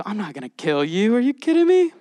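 mock disbelief, leaping high on "Are you kidding me"; joking incredulity. Preacher voices God reassuring Gideon, joking and incredulous. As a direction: play it as a teasing, incredulous reassurance. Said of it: "God goes".

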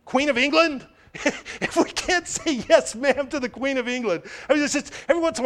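high-pitched disbelief with a laugh; incredulous. Real, not acted. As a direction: laugh in exasperation at how absurd it is. Incredulous at rudeness to the Queen.

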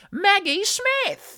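slow, high, loud Maggie Smith; grand reveal. Acted. Hosts unveiling their celebrity friend, a slow loud reveal. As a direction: announce the star like a prize.

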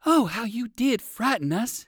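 high, fluttery exclamation of fright; frightened exclamation. A narrator's reading. Arabella scolds Percival after the scare, exclaiming. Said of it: "exclaimed Arabella, whose heart was still going pitter-patter".

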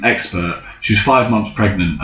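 ordinary talk, nothing marked

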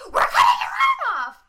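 high, loud, frantic yell; hammed-up panic over the arm; mock panicked shout. Shown as a demonstration. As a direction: overdo it as a hysterical scream. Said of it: "she doesn't need to be like".

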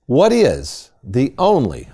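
"only" stretched slowly for emphasis; stress on only. Real, not acted. Host quizzing his co-host, heavy stress on "only". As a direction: lean slowly and firmly on "only". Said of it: "I'm emphasizing only".

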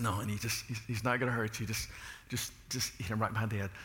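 soft, hushed reassurance; calm her down gently; quietly coaxing his wife to hit the snake; coaxing husband. Retold or imitated. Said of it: "I said".

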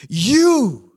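loud, high shaking-fist cry to God; preacher acting out a despairing psalmist's anguished shout; anguished protest. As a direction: hurl the psalm at heaven in protest. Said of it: "Do you hear him?".

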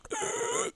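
very high sharp gasp; dramatic gasp. Retold or imitated. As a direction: gasp like a cartoon villain. Mimicking villain's dramatic gasp.

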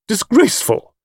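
high, put-on indignant voice; outraged matron. A narrator's reading. Mrs Caldecott outraged at paying extra, indignant. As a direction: pronounce it with haughty disgust.

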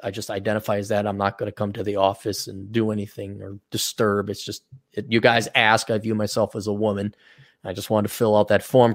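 low, soft, understated mock explanation; deadpan excuse. Man explains to office staff his form entry, deadpan and low. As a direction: play the reluctant employee explaining himself quietly.

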